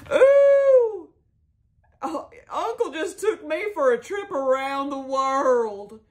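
high, loud, slow gush that stays lifted; gushing Jolene. Retold or imitated. Jolene bragging about the uncle at dessert, high gushing. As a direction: swoon over the trip like a show-off. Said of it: "she goes".

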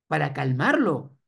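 ordinary delivery

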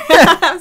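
high squealing burst of laughter; loud squealing laugh. Real, not acted. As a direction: shriek with laughter. Laughing at friend's line.

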